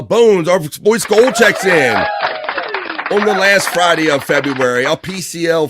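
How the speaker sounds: stretched, loud hyped greeting to a chatter; hyped shout-out. Real, not acted. Host hyping up a regular chatter, loud and stretched. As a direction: drag out the shout-out like a radio host.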